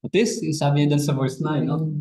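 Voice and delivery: ordinary delivery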